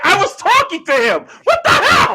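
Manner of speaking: high, loud, laughing disbelief; mock amazed shout. Fan realizing who he had talked to, mock amazed shout. Acting out amazed future fans.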